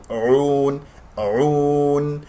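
long chanted "عون" from mid-throat; deep throat ayn. Shown as a demonstration. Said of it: "والعين عميقة من وسط الحلق".

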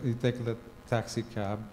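ordinary delivery

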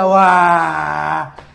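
un guau largo, gritado en burla; asombro imitado. Retold or imitated. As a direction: imita a todos exclamando asombrados. Mimicking everyone's amazed reaction. (asombro fingido, a voces)

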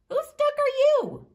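high, loud demanding call; demanding question. Acted. Questioner calling to the duck, high and loud.

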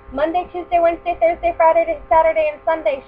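high, loud call across the house; mother calling her children home, loud. Acted. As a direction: call the children's names from afar. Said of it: "she called".

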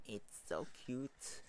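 slow, softened coo of adoration; hushed, drawn-out adoring murmur; soft gushing. Real, not acted. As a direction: coo it softly, drawing the words out. Gushing, softer take before squeal.

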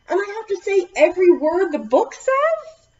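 high loud whine; mock dismay. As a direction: pretend horror at the rule. Reader protests the book.

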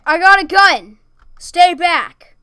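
loud, high armed yell; shouting threat. Real, not acted. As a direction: bark it like a threat.

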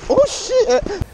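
sudden high loud yelp of alarm; startled oh shit. Real, not acted. Vlogger at cliff edge startles, yelping in alarm. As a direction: jolt back from the drop.

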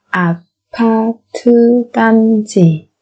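slow, separated syllables for learners; careful teacher's slow model. Shown as a demonstration. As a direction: spell it out slowly, beat by beat.

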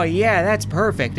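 usual speaking voice

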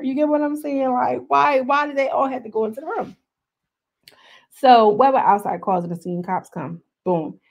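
ordinary talk, nothing marked

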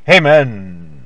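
ordinary delivery

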